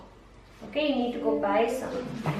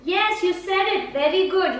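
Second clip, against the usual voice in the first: excited praise; 6 semitones higher, 5 dB louder than usual. Real, not acted. Tutor praises the child for saying north, excited. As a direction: celebrate the right answer.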